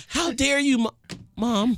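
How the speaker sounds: high-pitched pretend indignation; hammed-up "how dare you" squeal; mock outrage. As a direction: playfully act scandalized at mom. Host guesses the mock confrontation.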